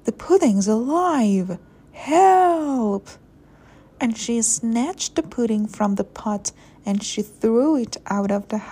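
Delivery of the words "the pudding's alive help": alarm building to a high, loud cry; storybook panic, louder and higher to the end; panicked cry. A narrator's reading. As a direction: cry out for rescue in acted alarm. Mother panics at living pudding.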